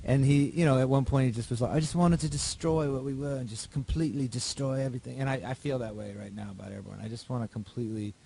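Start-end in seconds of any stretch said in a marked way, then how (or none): 1.7-5.2 put-on a light British accent, a bit higher and louder; mock British accent. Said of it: "my terrible British accent".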